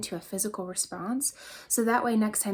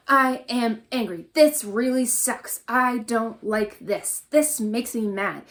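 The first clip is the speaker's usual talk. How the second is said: louder, slow and punched from the core; forceful venting; 6 dB louder, slower than usual. Shown as a demonstration. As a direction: push the anger out, really give it oomph. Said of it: "Really give it some, some oomph".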